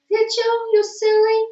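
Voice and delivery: very high, loud pet voice. Real, not acted. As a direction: tease the cat in a high squeal. Teasing the cat.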